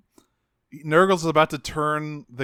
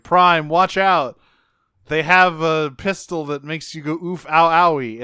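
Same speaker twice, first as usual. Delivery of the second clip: urgent radio warning; 2 semitones higher, 6 dB louder than usual. Retold or imitated. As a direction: shout a warning over the radio. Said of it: "Wheeljack radios and is like".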